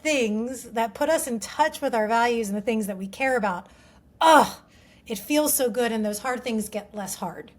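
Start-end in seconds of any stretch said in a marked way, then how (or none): 4.2-4.6 loud, higher groan of relief; emphatic sigh-like groan; heartfelt groan. Real, not acted. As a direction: let out a big satisfied "ugh". Relishing how good it feels.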